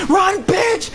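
high urgent shout; urgent yell. Real, not acted. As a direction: drive him to run. Urging partner to flee.

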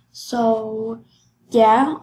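usual speaking voice

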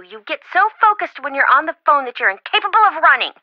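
normal talking voice

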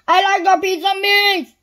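loud, high booming shout. A narrator's reading. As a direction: bellow the order across the shop. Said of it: "he boomed".

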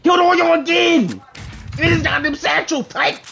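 high, loud gloating yell; triumphant shout. Real, not acted. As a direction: yell in victory. Gloats after wiping team.

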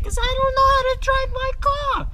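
very high-pitched self-mocking yelp; hammed-up exasperation, voice shooting high; exasperated mock rant. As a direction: climb into a squealed Jersey complaint.

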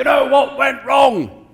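loud, high, drawn-out angry bellow; imitated shouting. Managing director storming into project manager's office, imitated shouting. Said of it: "stormed into his office and shouted".

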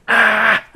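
loud, high mock swimmer scream. Joking about swimmers, a loud mock scream. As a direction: yell like a swimmer gasping for air. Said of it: "they just scream instead".

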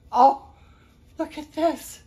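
loud high burst of delight; excited exclaim. Real, not acted. Vlogger finding something new, loud delighted burst. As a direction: shout it out, thrilled.

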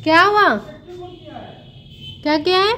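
high, loud questioning; pet talk. Real, not acted. Asking the pet what happened, high pet-talk. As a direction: ask a pet in a high, lifted voice.

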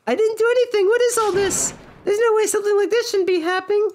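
very high cartoon voice, protesting; flustered high-pitched character. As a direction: squeak out a worried denial. Plays a game character.

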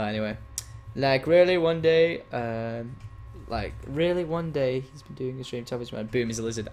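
ordinary talk

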